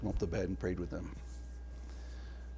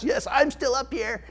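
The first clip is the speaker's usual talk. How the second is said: put-on higher, childlike call from upstairs; child's voice; 11 semitones higher than usual. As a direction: play the kid answering defiantly from the bedroom. Voicing sent-to-bed child.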